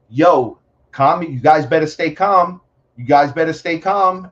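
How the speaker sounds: called-out warning. Retold or imitated. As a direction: call out loud to warn them off. Imitates man calling out warning.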